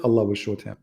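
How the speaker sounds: ordinary delivery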